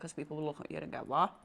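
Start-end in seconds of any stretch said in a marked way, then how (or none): 1.1-1.3 retold baffled "what?"; mock incredulous. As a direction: imitate an onlooker taken aback. Voicing people's shocked reaction.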